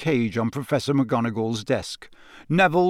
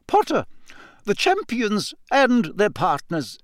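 higher, measured teacher; a hint of the stern schoolmistress; lightly voiced McGonagall; 8 semitones higher than usual. A narrator's reading. McGonagall tells Harry about partners, measured teacher voice. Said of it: "McGonagall addresses Harry".

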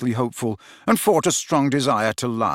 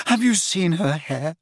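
high, dreamy rapture; breathless; 8 semitones higher than usual. A narrator's reading. Lovesick lad raving about his beloved, breathless. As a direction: swoon slowly over each feature. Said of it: "repeated Ron breathlessly".

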